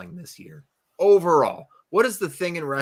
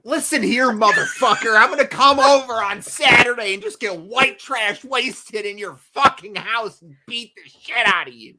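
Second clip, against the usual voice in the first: louder, hammed-up tough-guy threat; mock threat; 8 semitones higher, 7 dB louder than usual. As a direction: play the bar brawler for laughs. Playful threat to a friend.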